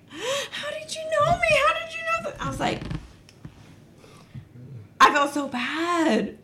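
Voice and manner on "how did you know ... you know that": high, soft mock-crying voice; imitated tearful crying. Imitating the woman who started crying, tearful high whimper. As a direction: imitate her crying, small and high-pitched. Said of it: "She started crying. She's like".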